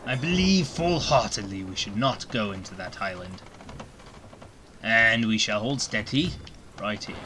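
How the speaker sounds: lightly voiced pirate captain, a hint of gruff. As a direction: play a sea captain steering through a storm. Pirate talk in Sea of Thieves.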